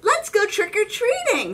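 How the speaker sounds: high, loud excited call; can't wait to go; high and loud, bursting with excitement; eager Pete. Acted. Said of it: "says Pete".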